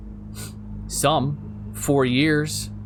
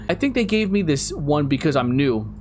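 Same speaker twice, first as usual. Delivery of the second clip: ordinary delivery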